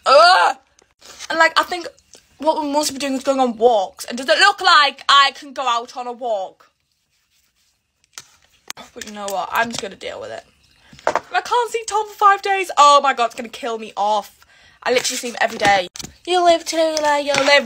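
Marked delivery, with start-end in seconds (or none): 0.1-0.6 loud exasperated groan, higher; frustrated ugh. Real, not acted. Frustrated about having to pack. 16.3-17.7 a lightly voiced someone saying "you'll live", higher; mock dismissive voice. Mimics someone brushing her off.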